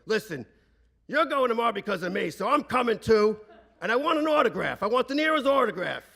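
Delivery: loud, high-pitched demanding yell. Retold or imitated. Father yelling at Uncle Frank from the dining room, demanding. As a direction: bellow orders like an irate father on the phone. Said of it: "I hear him yelling from the dining room".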